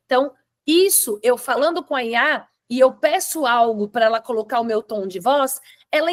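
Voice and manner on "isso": ordinary talk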